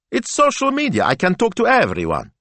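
high-pitched exasperated protest. A narrator's reading. Assistant explains social media to her boss, exasperated. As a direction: explain it as if it's obvious. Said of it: "sounding a little exasperated".

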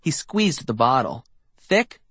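ordinary delivery, nothing marked

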